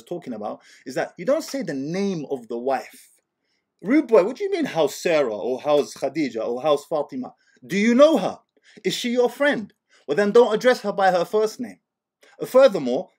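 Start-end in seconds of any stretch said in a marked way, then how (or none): 3.8-13.1 loud, high mock outrage at the rude question; playful loud scolding; mock indignation. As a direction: tell him off in jest, loudly and higher.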